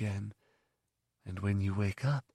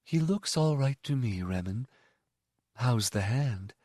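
softer, kindly and calm; gentle mother; 3 dB quieter than usual. Acted. The mother dismisses the boy, soft and kindly. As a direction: speak warmly to the boy.

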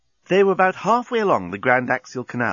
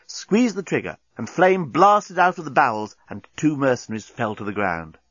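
ordinary delivery, nothing marked

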